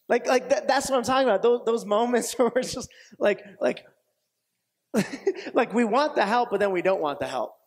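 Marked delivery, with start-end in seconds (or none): none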